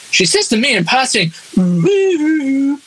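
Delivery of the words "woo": high playful woo; teasing high woo from the lyric; mock woo. Quoting the song's woo.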